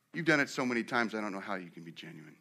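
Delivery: low voice, dropping to very soft; quiet doubting reply. Acted. Elijah doubting a repeated apology, quiet reply.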